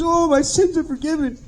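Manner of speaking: high wail of relief, acted out; imitated tearful cry. Storyteller voicing a forgiven man to the preacher, imitated tearful cry. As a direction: mimic a man weeping for joy. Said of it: "He's crying out with tears".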